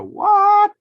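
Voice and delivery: shrill high startled cry; shocked what. Pretend. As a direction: yelp it in disbelief. Dragged into court, shocked.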